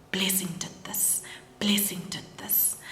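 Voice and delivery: hushed, breathy whisper of dread; retold whisper. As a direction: whisper it in horror, repeating. Shocked mom realises the curse.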